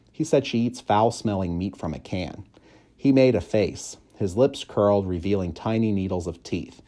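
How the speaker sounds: ordinary delivery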